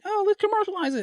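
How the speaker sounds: mocking executive voice.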